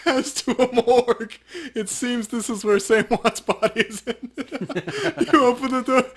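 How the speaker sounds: put-on high old granny voice, slow and laughing through it; grandma voice. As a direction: keep up the granny narration, giggling. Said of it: "Thanks, Grandma".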